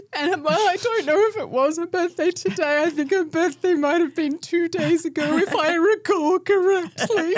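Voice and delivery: loud, very high mock wailing; hammed-up tearful squeal; imitated child's crying. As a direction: bawl it like an upset kid, slowing at the end. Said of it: "a good cry in the back of the car".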